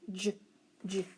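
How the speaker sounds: "J" as its short consonant sound, "juh"; isolated letter sound. Shown as a demonstration. Teacher quizzing pupils on a sound, "J" said as "juh". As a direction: say "J" as a brief "juh", not the letter name. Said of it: "sound J".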